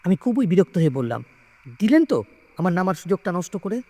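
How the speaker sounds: usual speaking voice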